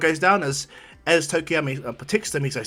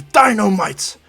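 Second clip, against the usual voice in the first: loud, higher, drawn-out yell; battle cry; 6 semitones higher, 9 dB louder, much slower than usual. Acted. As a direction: shout it like an attack name. Battle cry in retold fight.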